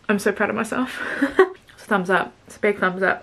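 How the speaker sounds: fork against the lips; speaking through a mouthful; talking while chewing food. Real, not acted. Seen 0: Bites at -1.0; fork at lips to 1.4, hand covers mouth.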